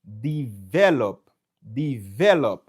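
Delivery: Develop slowly as de-VE-lop; stress on VE. Shown as a demonstration. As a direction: press hard on the middle syllable of Develop. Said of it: "m fonse sou ve an".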